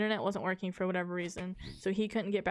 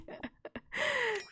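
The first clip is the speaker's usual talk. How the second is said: a squeaky high-pitched burst of giggling; high giggle. Real, not acted. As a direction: giggle high and helpless at his misfortune. Laughing at friend's frustration.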